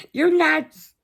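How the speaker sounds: mock exasperated. [name]'s retort.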